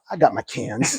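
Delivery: low, soft aside; understated triumph. Retold or imitated. Man walking back with his cans, low quiet triumph. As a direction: say it quietly under your breath. Walks back relieved, wife watching.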